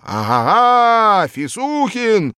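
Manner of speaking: high, loud slow bellow; loud gloating threat; triumphant roar. A narrator's reading. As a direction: roar as if you've caught him. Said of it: "Стёкла в окнах дрогнули от рёва".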